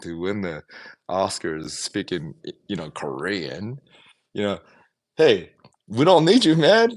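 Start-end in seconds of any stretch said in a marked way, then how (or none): 5.2-6.9 loud, high defiant call; defiant shout. Retold or imitated. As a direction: shout it back at Hollywood. Voicing Parasite's defiant message.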